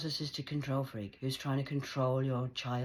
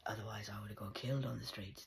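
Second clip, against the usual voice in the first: whispered; 5 semitones lower, 8 dB quieter than usual. Real, not acted. Grim aside.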